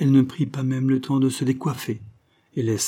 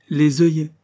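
bewildered repetition, louder and higher; puzzled echo; 6 semitones higher, 7 dB louder than usual. Acted. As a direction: echo the word as if it makes no sense. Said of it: "comme quelqu'un qui ne comprend plus". (répétition perplexe, plus haute et plus forte)

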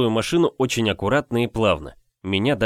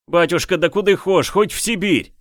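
crowd roaring; 6 semitones higher than usual. Acted. Crowd roars from kneeling mass.

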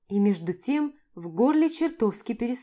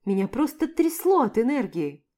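ordinary delivery, nothing marked

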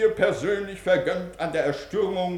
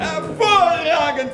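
enthusiastic praise; 6 semitones higher, 7 dB louder, slower than usual. Acted. Director praising pupil loudly.

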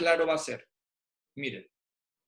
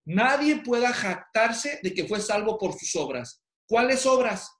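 fervent preaching; 3 semitones higher than usual. Real, not acted. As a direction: proclaim it to the congregation. Preaches the application to congregation. (predicando con fervor, más agudo y más fuerte)

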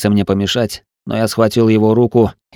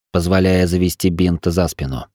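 usual speaking voice

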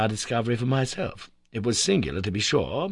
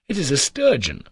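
louder and higher, keen to name it; eager cry; 5 semitones higher, 5 dB louder than usual. A narrator's reading. Nephew names the fish, eager cry. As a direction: call out the guess eagerly. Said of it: "I cried".

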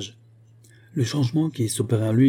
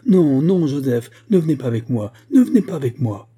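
raised-pitch, loud pleading that eases and surges; alarmed cry; 4 semitones higher, 6 dB louder than usual. A narrator's reading. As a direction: cry out, begging him to stay back. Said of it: "s'écria Sampson en voyant son frère". (supplication criée, alarmée)

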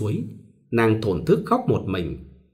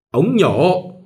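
higher, louder barked order; curt order; 6 semitones higher, 4 dB louder than usual. A narrator's reading. Mother-in-law ordering the spittoon, higher and louder. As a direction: demand it in one word. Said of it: "bà ngửa cổ lên bảo".

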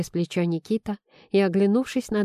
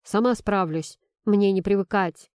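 ordinary delivery

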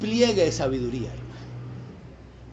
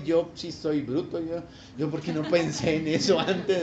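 praying believer; 4 semitones lower, 4 dB quieter than usual. Retold or imitated. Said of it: "le viene y dice".

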